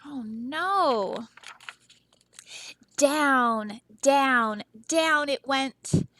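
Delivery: slow drawn out down down down; dramatic storytelling. Acted. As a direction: let it sink slowly. Read-aloud dramatic sinking.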